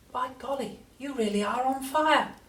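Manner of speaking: high grandson voice, volume held back; wondering storybook boy; amazed George. A narrator's reading.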